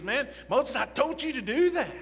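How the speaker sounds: high, frustrated scolding; exasperated Lord. Retold or imitated. The angry Lord scolds Moses for refusing, exasperated. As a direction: scold Moses like a fed-up boss. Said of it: "the Lord was getting angry".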